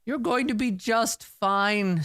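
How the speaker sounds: high, slow put-on bedside voice; exaggerated reassurance. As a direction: lay on the comfort after a wink. Winking sorcerer reassures patient.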